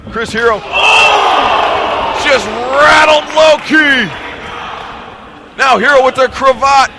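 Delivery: high loud play-by-play shout; excited call. Real, not acted. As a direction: hype the hit. Big strike called live.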